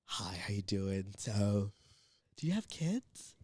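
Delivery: softly imitated woman; gently voiced retold inquiry; soft small talk. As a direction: make polite, hushed small talk. Lady making small talk, retold.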